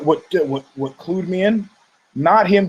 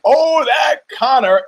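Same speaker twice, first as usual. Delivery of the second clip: much higher, louder, slow gushing cry; exclaimed catchphrase; 9 semitones higher, 3 dB louder, slower than usual. Retold or imitated. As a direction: shout it with delight like the studio crowd. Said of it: "Exclamation point".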